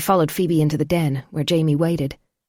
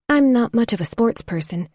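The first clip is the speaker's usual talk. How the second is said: lightly voiced woman, higher; young woman voice; 7 semitones higher than usual. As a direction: lift into a woman's voice.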